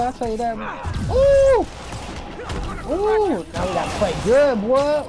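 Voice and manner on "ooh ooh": loud high yelp of surprise; sudden high gamer yelp; excited yelp. Real, not acted. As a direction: yelp as the hit lands. Hit in a game fight.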